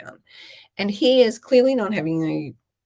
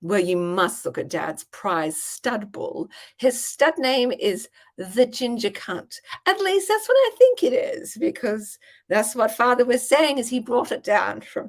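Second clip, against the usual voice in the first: gushing hostess act; 5 semitones higher than usual. Retold or imitated. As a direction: play the innocent daughter proudly presenting a prize bull. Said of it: "I was like".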